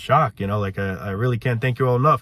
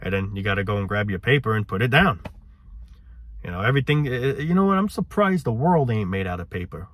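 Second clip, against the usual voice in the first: normal talking voice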